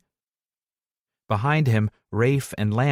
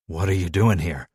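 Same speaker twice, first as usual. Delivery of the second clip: rough voice; 5 semitones lower than usual. Acted. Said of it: "His voice is rough".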